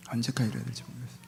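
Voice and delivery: weary echo of the prayer; soft despairing prayer. Acted. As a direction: let it drop away. Despairing prayer, echoed softly.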